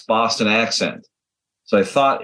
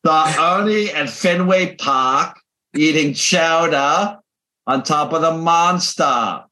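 put-on slow, high Boston accent; exaggerated, drawn-out dropped-r Boston; 4 semitones higher, slower than usual. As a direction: spell out the Boston vowels slowly, pitched up. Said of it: "Here's another one they tried".

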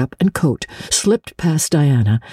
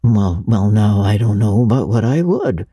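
a lightly voiced, lower, hesitant old farmer; shy old Matthew; 5 semitones lower than usual. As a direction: mumble like a bashful old man.

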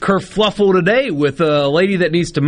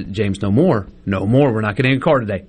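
calm gentle no; 7 semitones lower than usual. Retold or imitated. Dad showing how he calmly tells his son no. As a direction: soothe a toddler, steady and low. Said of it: "I just kind of pat his chest".

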